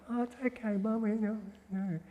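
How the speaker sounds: put-on very high-pitched, kindly cooing to a baby; soothing, comforting mother. Mother to her crying baby, soothing and kind. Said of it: "that tone of voice that's soothing and kind and comforting".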